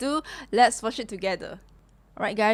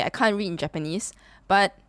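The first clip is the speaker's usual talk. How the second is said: usual speaking voice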